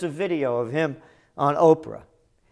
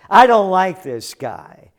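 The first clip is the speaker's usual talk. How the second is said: ordinary talk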